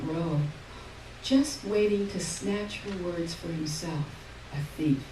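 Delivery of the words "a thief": plain reading voice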